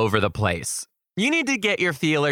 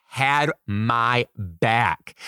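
slow emphatic; 3 semitones lower, much slower than usual. Real, not acted. Savoring the win.

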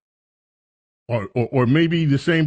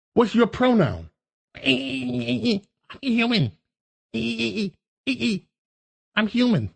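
mock mouse interview; 6 semitones higher than usual. Mock dialogue with a humanized mouse.

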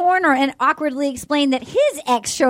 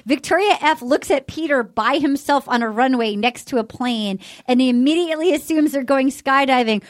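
ordinary talk

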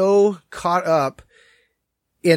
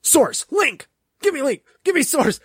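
mocking demanding arguer; 8 semitones higher than usual. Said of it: "that type of person in an argument".

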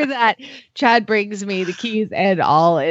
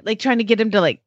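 normal talking voice

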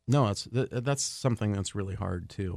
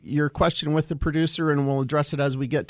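loud, high, upbeat radio host; radio announcer; 7 semitones higher, 7 dB louder than usual. Acted. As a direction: announce it like a bright on-air host. Radio host returns from break.

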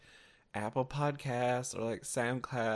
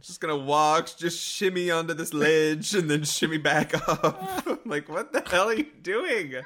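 laughing mock disbelief; 4 semitones higher, 8 dB louder than usual. Real, not acted. Mocking a contestant's stage move.

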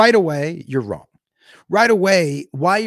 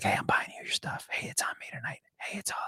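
put-on whispering the drunk guy leaning in; hushed, voiceless, conspiratorial; imitated whisper; 15 dB quieter, faster than usual.

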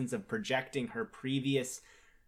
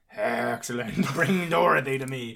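villainous Gnome King; 6 dB louder than usual. Retold or imitated. Gnome King's retold villain command.

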